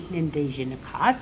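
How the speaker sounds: normal talking voice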